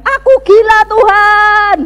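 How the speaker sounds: loud, high cry to God; stressed-out shout. Retold or imitated. Stressed student crying to God, shouting. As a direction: re-enact a frazzled outburst in prayer. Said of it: "kalau saya sudah stres".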